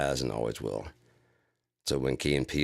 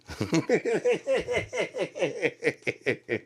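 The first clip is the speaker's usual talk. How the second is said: breathy high-pitched laughing. Real, not acted. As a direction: let the laugh run high. Laughing at own sketch.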